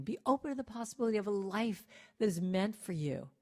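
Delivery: ordinary delivery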